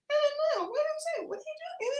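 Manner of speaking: put-on tiny, very high, soft little-girl voice; sweet baby-talk in a very high, hushed pitch. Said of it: "It's the baby voice".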